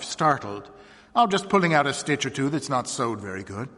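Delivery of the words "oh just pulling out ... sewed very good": ordinary delivery, nothing marked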